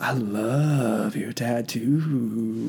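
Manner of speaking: slow, low Southern woman drawl; softly drawled mock Southern accent. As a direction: imitate a Southern woman, stretching every word. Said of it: "That is not how I sounded".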